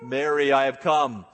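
loud proclaiming trumpet-like herald voice. Pretend. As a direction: announce it like a fanfare. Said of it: "trumpet voice".

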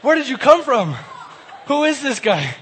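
high, loud, flustered and laughing; high-pitched jumpy disbelief with laughter; startled panic. Acted. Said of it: "I can imagine him".

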